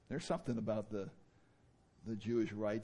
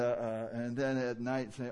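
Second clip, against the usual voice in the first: usual speaking voice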